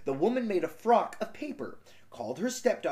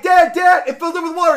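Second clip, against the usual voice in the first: high, loud frantic calling; panicked daughter; 12 semitones higher, 12 dB louder, faster than usual. Retold or imitated. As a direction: yell for dad in a panic. Daughter voiced calling father.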